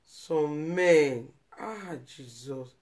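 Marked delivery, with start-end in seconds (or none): 1.6-2.8 slow, much softer, lower sigh; drawn-out pained sigh; sorrowful groan. Real, not acted. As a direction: let it fall out in grief. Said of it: "the thing touch me".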